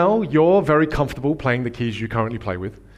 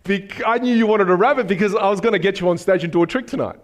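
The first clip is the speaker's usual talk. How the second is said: playacted enthusiasm; 5 semitones higher than usual. Improvising the gift-giver's reply.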